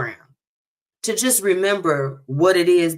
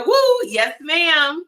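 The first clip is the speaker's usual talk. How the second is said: high, loud hype cheer; squealing auntie hype; Auntie Tracy cheering; 10 semitones higher, 3 dB louder, slower than usual. Retold or imitated. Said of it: "Auntie Tracy said".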